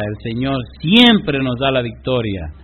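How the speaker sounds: ordinary delivery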